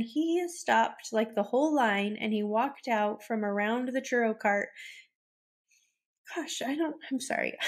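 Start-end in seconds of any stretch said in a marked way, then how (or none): 6.3-7.6 fighting back tears while apologizing; real tears. Real, not acted. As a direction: voice catching as she starts to cry. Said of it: "I shouldn't cry about it every time".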